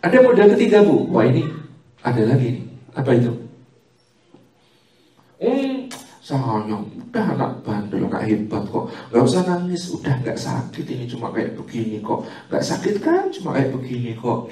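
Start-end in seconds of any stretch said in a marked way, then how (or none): 5.4-14.5 soft, low comforting croon; quiet reassuring mother's murmur; hushing mother. Retold or imitated. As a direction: soothe a crying toddler gently. Mother hushing a crying child.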